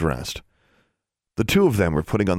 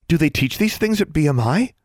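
breathless; 10 semitones higher than usual. Acted. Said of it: "Darla asked breathlessly".

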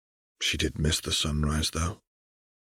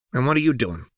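challenging; 6 semitones higher, faster than usual. Acted. Jinx challenges Echo.

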